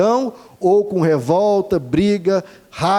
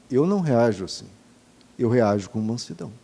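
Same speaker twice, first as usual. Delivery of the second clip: a soft, humble voice of Jesus; calm, meek Jesus; 8 semitones lower, 5 dB quieter, faster than usual. Retold or imitated. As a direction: lower and softer, gently. Voicing Jesus, calm answer.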